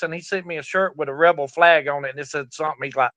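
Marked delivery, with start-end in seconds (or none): none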